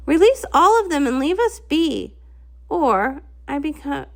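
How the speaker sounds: usual speaking voice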